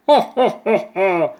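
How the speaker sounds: loud mocking laugh; goat bursts out laughing. A narrator's reading. Goat laughing at the tiny ant, loud and mocking. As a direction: laugh down at the little bug. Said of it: "burst out laughing".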